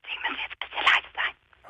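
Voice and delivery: hushed whisper; whispering so nobody wakes; whispering on the phone. Real, not acted.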